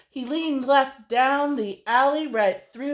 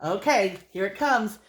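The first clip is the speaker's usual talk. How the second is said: plain reading voice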